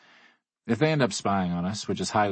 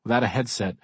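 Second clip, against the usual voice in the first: ordinary delivery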